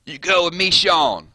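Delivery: mock Southern accent as Rick; Rick's Southern accent. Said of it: "Rick looks at Carl and says".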